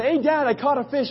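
put-on high-pitched boy telling Dad; high kid-like boast; little boy. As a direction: imitate an eager child showing off a catch. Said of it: "being a little boy".

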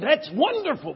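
high, loud, gushing Southern pastor; Deep South accent. Retold or imitated. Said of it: "somebody from way down south".

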